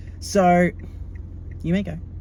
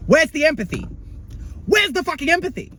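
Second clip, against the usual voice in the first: much higher, disbelieving rant; indignant outrage; 11 semitones higher than usual. Pretend. Ranting to viewers about the classmate's coldness, high and indignant. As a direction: demand it, scandalised.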